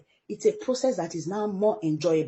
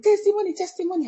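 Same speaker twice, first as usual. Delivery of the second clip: a much higher, louder cry of testimony; mock excitement; 10 semitones higher, 5 dB louder, faster than usual. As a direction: ham up the eager crowd. Mocking excited churchgoers.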